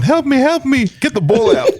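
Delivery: very high, laughing mock cry for help; mock plea. Retelling a teammate's joke, laughing high mock plea. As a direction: squeal it like he's being crushed in the scrum. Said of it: "as a Lou said when he's going".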